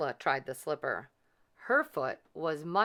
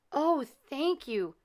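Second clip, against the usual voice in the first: grateful Cinderella; 8 semitones higher, slower than usual. A narrator's reading. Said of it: "said Cinderella".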